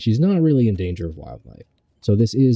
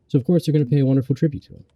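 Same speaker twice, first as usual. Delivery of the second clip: higher and fast; excited; 6 semitones higher, much faster than usual. Pretend. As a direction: rush it out excitedly. Excited build-up to tribute.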